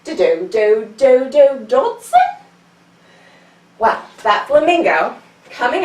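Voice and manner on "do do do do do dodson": dodo call. Shown as a demonstration. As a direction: chant it like a proud little bird. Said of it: "we'll say".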